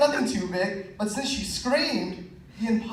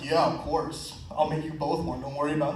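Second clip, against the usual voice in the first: lower, softer smooth-talker voice; smooth cool guy; 5 semitones lower, 5 dB quieter than usual. Retold or imitated. Offering quesadillas to the girls, low smooth cool voice. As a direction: play it cool and suave for the girls.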